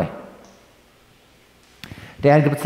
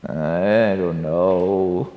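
much lower, softer and very slow, half asleep; sleepy mumble; 10 semitones lower, 5 dB quieter, much slower than usual. Acted. Sleepy daughter answering mom, slow drowsy mumble. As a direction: mumble it from under the covers.

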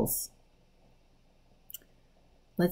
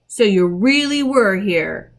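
slow, louder accusation; accusing; 3 semitones higher, 7 dB louder than usual. A narrator's reading. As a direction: confront someone caught lying. Peter catches Edmund in a lie.

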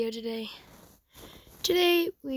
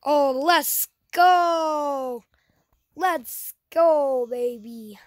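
loud drawn-out victory cheer; excited shout; 4 semitones higher, 9 dB louder, much slower than usual. Real, not acted. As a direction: yell it out after winning. Winning a close race.

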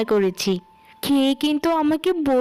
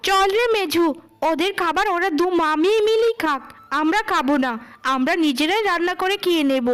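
put-on higher-pitched, indignant young woman talking back; angry elder sister; 7 semitones higher than usual. Eldest daughter protesting her mother's scolding, higher and indignant.

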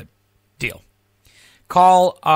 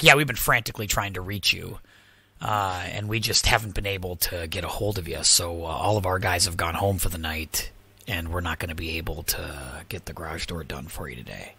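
lower, softer droning customer-service voice; mock company excuse; 13 semitones lower, 9 dB quieter than usual. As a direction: parody a bored rep reading excuses. Mocking the repair company's excuse.

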